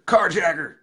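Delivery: loud, high rowdy holler. Retold or imitated. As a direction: holler a nickname down the phone. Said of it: "pick up the phone and I hear".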